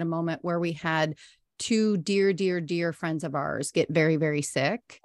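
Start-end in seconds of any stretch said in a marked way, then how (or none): none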